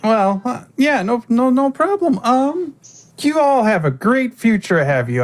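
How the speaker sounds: a high, bright fairy lady; high NPC voice. Acted. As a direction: chirp it sweetly like a fey host. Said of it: "Ruby goes".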